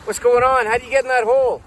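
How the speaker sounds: surprised shout from above; yelling down. Acted. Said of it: "they yell down at you".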